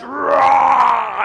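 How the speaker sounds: loud, drawn-out monster roar; mock Hulk roar. Pastor acting out the Hulk's transformation, hammed-up roar. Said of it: "And then he goes".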